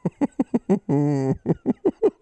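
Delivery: high, long giggling laugh; helpless rapid high chuckles; laughing fit. Real, not acted. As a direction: crack up at your own innuendo. Cracks up over tutties.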